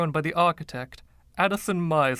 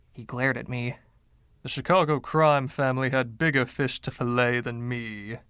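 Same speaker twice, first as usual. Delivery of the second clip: ordinary delivery, nothing marked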